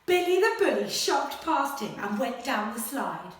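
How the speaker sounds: animated picture-book read-aloud; reading to children. Acted. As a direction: read it like a bedtime adventure. Story reading continues.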